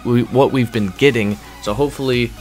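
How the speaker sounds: ordinary delivery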